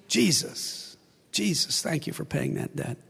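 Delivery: soft, hushed thanks to Jesus; a quiet, sincere prayer under the breath; whispered prayer. Real, not acted. Preacher praying thanks to Jesus.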